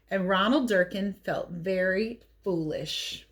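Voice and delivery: usual speaking voice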